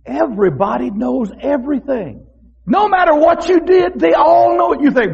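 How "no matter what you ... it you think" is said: ordinary talk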